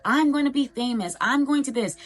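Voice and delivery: mock a kid sure they'll be famous; excited kid. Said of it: "and they're like".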